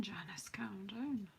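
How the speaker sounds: real whisper, calming herself down; whispered self-talk, calm down Janice. Real, not acted. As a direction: hush yourself, talk yourself down under your breath. Calming herself after a cutting mistake.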